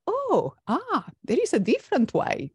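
acting out the moment of seeing something new; surprised realization. Guest voicing her own surprised discovery of other ways. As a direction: pitch jumps way up on the oh, then eases down.

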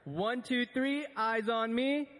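high rising sing-song call; kindergarten teacher voice. Shown as a demonstration. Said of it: "Our kids are one-upping you guys right now".

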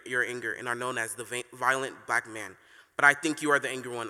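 ordinary talk

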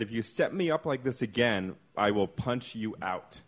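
ordinary talk, nothing marked

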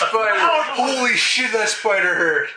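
loud, high outcry; shouting in pain. Acted. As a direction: yell as if just bitten. Player reacts in character.

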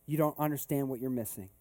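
ordinary talk

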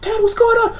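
put-on high-pitched child voice; young boy. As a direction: play a confused little boy. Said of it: "like, a young boy".

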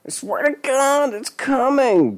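very high, wailing plea; desperate pleading. A narrator's reading. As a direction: beg for your life up high. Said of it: "Denny pleaded".

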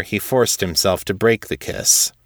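ordinary delivery, nothing marked

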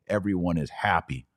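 usual speaking voice

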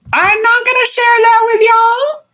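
very high, playful put-on voice; playful high squeal. Host won't share clients' secrets with listeners, teasing high voice. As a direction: tease in a high, held squeal.

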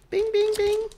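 high, bright correct-answer chime; game show ding. Pretend. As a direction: ring it out like a quiz show bell.

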